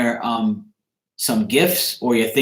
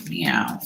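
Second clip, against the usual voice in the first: low cat meow; 11 semitones lower, 6 dB quieter than usual. Pretend. Calling to his missing cat friend.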